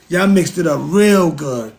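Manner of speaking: slow, leaning on "real good"; slow emphatic. Shown as a demonstration. Telling Europeans they mixed it up, slow and pointed. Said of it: "repeats line for emphasis".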